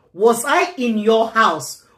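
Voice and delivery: normal talking voice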